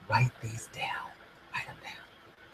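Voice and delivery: whispered urging, unvoiced. Real, not acted. Coach whispering to viewers to write it down. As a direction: whisper the reminder close to the mic.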